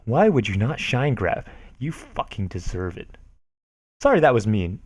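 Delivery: ordinary delivery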